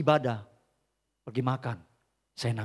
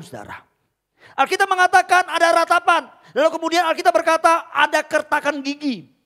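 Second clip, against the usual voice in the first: loud, higher warning about hell, slowing on the key phrases; fiery preaching; 14 semitones higher, 11 dB louder than usual. Acted. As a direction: thunder the warning at the congregation. Preaching about hell at full force. (berkhotbah lantang tentang neraka)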